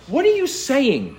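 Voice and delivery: high-pitched, disbelieving question; incredulous challenge. Acted. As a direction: confront them as if baffled. Preacher confronts Israel in disbelief.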